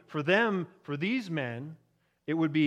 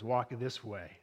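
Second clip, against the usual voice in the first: lower, softer echo of the servant's words; Frankenstein mimicking; 7 semitones lower, 7 dB quieter than usual. Frankenstein copying Igor down the stairs, lightly mimicking. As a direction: mimic him under your breath, lightly. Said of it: "Doctor Frankenstein grabs the cane and he mimics".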